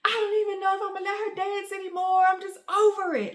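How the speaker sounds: high, loud fed-up outburst; meltdown. Retold or imitated. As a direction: re-enact throwing in the towel on the phone. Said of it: "it was a serious meltdown".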